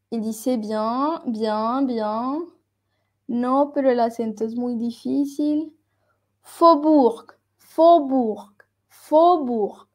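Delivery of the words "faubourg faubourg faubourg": "Faubourg" with the AU said as a pure O. Shown as a demonstration. Teacher models a hard word for students, AU as O. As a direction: say "faubourg" with AU like O, falling at the end. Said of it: "tú la pronuncias como una O".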